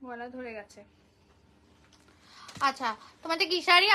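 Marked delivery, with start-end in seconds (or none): 0.1-0.8 real worn-out hoarse voice, much softer; breathless, voice giving out after a long live; out of breath and hoarse. Real, not acted. Saree seller tells viewers her voice is gone, breathless and hoarse. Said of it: "আমার গলা বসে গেছে আজকে".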